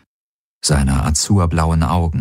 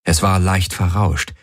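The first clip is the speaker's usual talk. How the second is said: ordinary delivery, nothing marked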